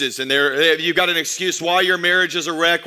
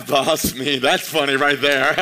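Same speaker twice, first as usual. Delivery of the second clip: laughing; 3 semitones lower, slower than usual. Real, not acted. Laughing at own joke.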